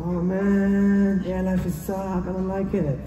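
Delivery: lightly voiced sulky complainer; mock gloomy self. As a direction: whine it like a fed-up slacker. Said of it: "I'm like".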